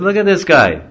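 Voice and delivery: high, loud jester's taunt to the crowd; mocking charioteer. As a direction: tease the crowd like a showman.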